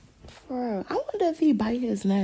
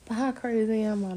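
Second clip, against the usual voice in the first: ordinary delivery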